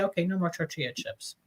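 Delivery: quiet, low inner resolve; firm self-talk. Retold or imitated. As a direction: say it to yourself quietly. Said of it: "I gotta finally say".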